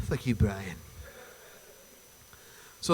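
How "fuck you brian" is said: a lightly voiced Stewie, lower and softer, sulky; exhausted Stewie. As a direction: mutter it like a worn-out, grumpy Stewie. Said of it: "Brian Stewie bullshit".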